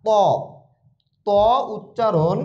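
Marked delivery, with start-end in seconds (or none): 0.1-1.3 ত heavy and dark with a rounded mouth; thick, rounded ত. Shown as a demonstration. Teacher showing the heavy letter, thick round ত. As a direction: round the lips for a full, thick ত. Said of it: "একটু মোটা হবে, মুখটা গোল হবে".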